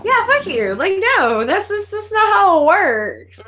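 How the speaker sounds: high, slow, indignant scoff; a high mock-angry protest about the movie; mock outraged. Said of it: "mock outrage".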